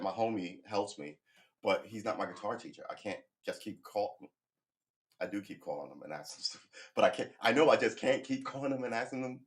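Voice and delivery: normal talking voice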